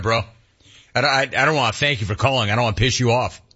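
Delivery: ordinary talk, nothing marked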